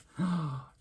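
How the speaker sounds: sharp, high gasp of delight. Real, not acted. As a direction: gasp in happy amazement. Delighted at the working result.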